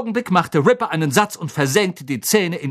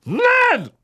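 loud refusal; 5 dB louder than usual. A narrator's reading. Harry refuses.

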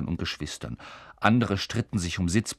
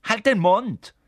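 angry snap; 11 semitones higher, 7 dB louder, slower than usual. A narrator's reading. Ron snapping at brothers.

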